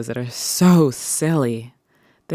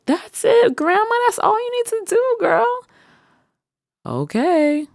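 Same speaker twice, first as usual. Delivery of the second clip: much higher, louder squeal of praise; much higher cheering praise to grandma; cheering grandchild; 4 dB louder than usual. Retold or imitated. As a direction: hype her up. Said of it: "What was that conversation like?".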